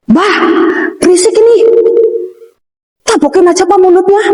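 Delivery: high-pitched complaining imitation; imitated child. Child complaining to grandmother about the noise, imitated high-pitched gripe. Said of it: "anak saya yang sini".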